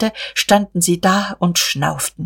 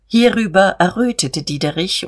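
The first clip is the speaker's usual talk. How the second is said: plain reading voice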